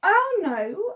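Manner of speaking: normal talking voice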